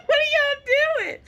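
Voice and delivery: high, slow, silly drawn-out question; squeaky woozy voice; giggly drugged self. Retold or imitated. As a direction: act loopy and delighted on sedatives. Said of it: "But on the outside, I'm like".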